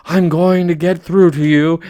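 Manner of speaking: loud, hammed-up furious vow; king vowing to break his daughter's defiance; loud angry King. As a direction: bellow it like an angry father king. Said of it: "King Triton".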